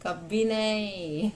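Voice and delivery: drawn-out words breaking into a laugh; scornful laughing. Real, not acted. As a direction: stretch it out slowly, then laugh. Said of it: "मुझे तो हंसी आ रही है".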